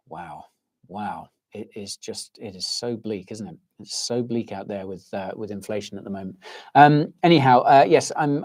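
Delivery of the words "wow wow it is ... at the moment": low, hushed disbelief; quiet dismay. Real, not acted. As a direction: let the voice sink right down, soft and low. Dismayed by inflation figures.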